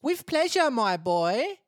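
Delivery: high, loud Essex lady; TOWIE Essex accent. Acted. Water lady granting a drink, TOWIE Essex accent. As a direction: answer gladly in broad TOWIE Essex. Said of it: "Can you make her towie".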